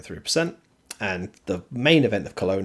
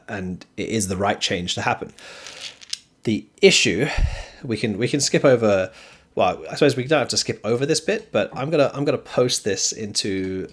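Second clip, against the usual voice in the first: usual speaking voice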